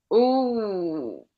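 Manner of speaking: ordinary talk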